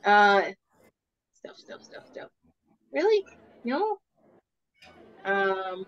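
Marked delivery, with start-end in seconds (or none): 1.3-2.3 under-the-breath repeating stealth; murmured search, barely voiced; whispered muttering. Real, not acted. Muttering while searching character sheet. 2.9-4.0 high, disbelieving "Really?"; startled double-check; surprised questions. Real, not acted. Surprised at own character sheet.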